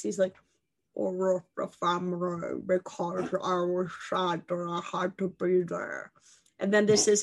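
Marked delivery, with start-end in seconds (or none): 0.9-6.1 softer, slow, mumbled royal voice; a hushed, slow princely murmur; Prince Harry. Retold or imitated. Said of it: "this is how Prince Harry speaks".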